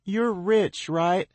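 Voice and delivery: high, loud call upward; girl calling up to him. A narrator's reading. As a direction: shout the question up. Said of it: "she calls up to me".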